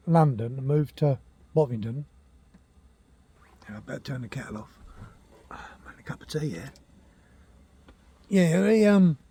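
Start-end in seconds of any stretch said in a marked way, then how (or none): 3.7-6.7 quick whispered aside to himself. Real, not acted. Old man whispers about his kettle, quick hushed aside. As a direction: drop to a hurried whisper, off-mic.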